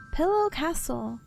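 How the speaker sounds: ordinary delivery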